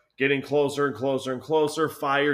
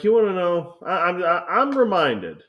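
louder, higher, heated comeback; mock angry retort; 7 semitones higher, 8 dB louder than usual. Telling off a coworker, louder and higher. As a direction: snap back at him, voice raised. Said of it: "I was like".

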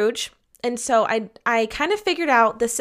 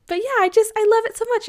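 excited; 11 semitones higher, 3 dB louder, faster than usual. Real, not acted. Gushing love for Thanksgiving.

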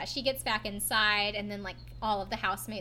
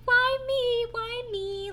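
high, loud then fading self-pitying whine; sad whine; 12 semitones higher, 5 dB louder, much slower than usual. Retold or imitated. Quoting Snooki to a friend, a high self-pitying whine. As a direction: plead it like a drunk girl shut out. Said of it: "Like, really sad".

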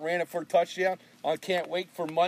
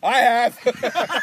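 cocky teammate; 5 semitones higher, 9 dB louder than usual. Retold or imitated. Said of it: "Mike Freeman goes".

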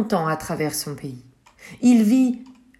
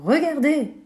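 put-on much higher, eager little boy; eager look; 8 semitones higher than usual. Bako calls the villagers to look, eagerly. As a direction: show them your treasure.

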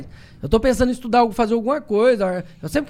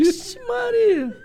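mock surprise; 13 semitones higher, much slower than usual. Real, not acted.

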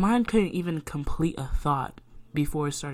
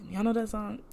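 laughing; 6 semitones higher, 8 dB louder, faster than usual. Real, not acted. Laughs after song.

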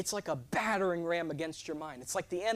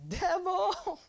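sudden high shout of Devil; 14 semitones higher, 4 dB louder than usual. Retold or imitated. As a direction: yell it out at the devil. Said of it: "I shouted".

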